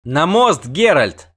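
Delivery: high, loud urgent command; shouted order. A narrator's reading. Sorceress sends the witcher onto the bridge, shouted order. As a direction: cry out an order to run. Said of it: "крикнула чародейка".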